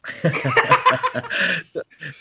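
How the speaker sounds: real burst of amused laughter; hearty laugh. Real, not acted. New Yorker joking about needless rudeness, hearty laugh. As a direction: crack up at your own joke.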